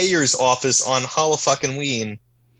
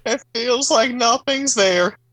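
high, slow put-on sitcom actor voice; Ray Romano; 7 semitones higher, slower than usual. Second try at the condom ad, high slow impression. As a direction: drag it out as a hammy celebrity impression. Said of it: "as Ray Romano".

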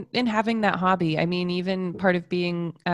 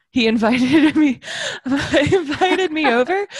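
laughing; 6 semitones higher, 6 dB louder than usual. Real, not acted. Laughing while telling the story.

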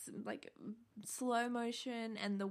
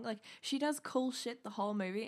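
normal talking voice

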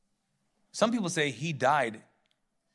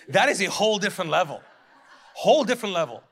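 fast, louder, laughing; laughing emphasis; 7 dB louder, faster than usual. Real, not acted. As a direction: crack up while making the point.

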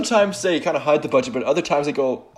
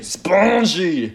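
put-on drawn-out, higher French-accented word; mock French accent; 5 semitones higher than usual. Co-host repeating Frenchie's catchword, drawn-out mock French accent. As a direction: stretch it out in a silly French accent. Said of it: "most pivotal Frenchie scene".

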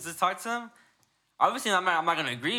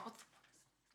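imitated whispered cursing; murmuring under breath; 26 dB quieter than usual. Scientologist muttering as she reads the manual, whispered under breath. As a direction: whisper it in mock disbelief. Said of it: "like, murmuring under her lip".

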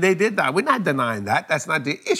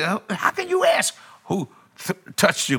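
irritated; 5 semitones higher than usual. Acted. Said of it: "the disciples got a little irritated".

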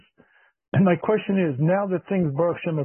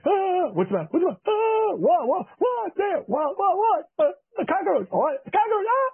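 much higher, frantic shrieks of terror at a cockroach; panicked screaming wife; 12 semitones higher than usual. Retold or imitated. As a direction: shriek like a wife standing on a chair.

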